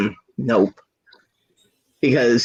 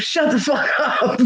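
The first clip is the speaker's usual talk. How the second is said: high, drawn-out exasperated comeback; mock angry retort; 11 semitones higher, slower than usual. Hurt man answering her teasing, mock exasperation. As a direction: imitate someone fed up, stretching it out.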